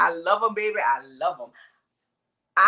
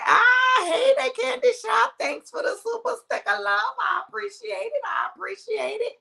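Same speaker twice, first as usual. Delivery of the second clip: very high, delighted thanks; excited squeal. Real, not acted. Squealed thanks for a super sticker.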